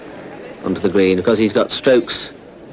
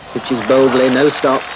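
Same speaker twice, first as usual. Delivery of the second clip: rising, louder as the ball runs; excited commentary; 4 semitones higher, 4 dB louder than usual. Real, not acted. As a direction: call the shot with building excitement. Live shot commentary.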